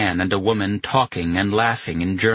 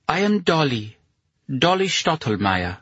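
put-on German accent; 7 semitones higher than usual. A narrator's reading. Dolly introduces herself.

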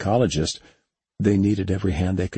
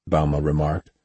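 usual speaking voice

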